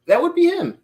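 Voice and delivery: high, eager repeat; hoping hard, voice jumping up; excited hopeful. Real, not acted. Repeats hoped pick, pitch jumps.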